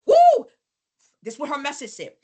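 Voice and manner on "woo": high loud whoop; excited whoop. Real, not acted. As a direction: let out a big excited woo. Exclamation before reading message.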